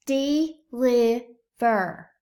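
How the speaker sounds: teacher sounding out deliver; slow syllables. Shown as a demonstration. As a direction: deliver split slowly into parts. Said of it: "blend them together".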